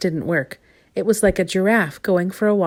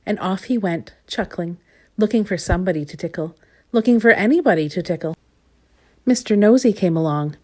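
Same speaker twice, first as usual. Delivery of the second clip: usual speaking voice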